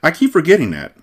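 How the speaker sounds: high, mildly aloof reply; slightly standoffish acted woman; a trifle standoffish. A narrator's reading. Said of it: "her tone were a trifle standoffish".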